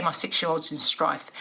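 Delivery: ordinary talk, nothing marked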